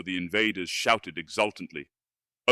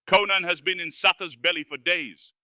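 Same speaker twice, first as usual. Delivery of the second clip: higher, strange wizard voice in frantic denial; squalling wizard; 9 semitones higher than usual. Acted. Wizard insists Conan is dead, squalling. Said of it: "squalled Sota, starting convulsively".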